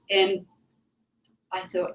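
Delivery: ordinary talk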